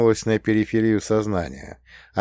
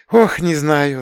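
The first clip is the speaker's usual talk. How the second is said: sighing; 8 semitones higher, 6 dB louder, slower than usual. A narrator's reading. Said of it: "вздохнула Настя".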